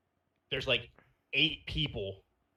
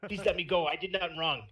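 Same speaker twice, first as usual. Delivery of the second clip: pleading, higher mock Chinese accent; 6 semitones higher than usual. Pleading with his captors in role play, mock Chinese accent. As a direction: beg in a put-on Chinese accent. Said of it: "I started doing a Chinese accent".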